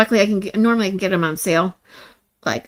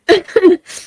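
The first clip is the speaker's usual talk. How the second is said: tearful laugh; 10 semitones higher, 6 dB louder than usual. Real, not acted. After blubbering remark.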